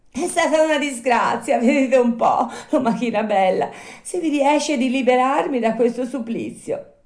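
high, loud puppet plea; pleading Pinocchio. Acted. Puppet stuck in door begging the snail, high plea. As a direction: beg for rescue in a high voice.

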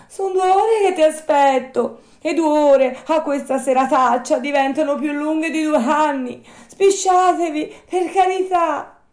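high, loud, pleading puppet shout; impatient shouting. Acted. Puppet kept waiting two hours, pleading high shout. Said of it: "gridò Pinocchio dalla strada".

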